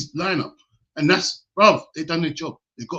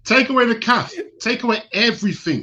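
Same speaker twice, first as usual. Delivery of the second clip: mock coach orders; 7 semitones higher than usual. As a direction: bark it like a strict coach. Mock coach ordering harsh training.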